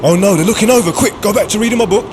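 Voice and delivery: flustered inner panic, the words tumbling out quick. Acted. Commuter caught staring on the train, flustered and rushed.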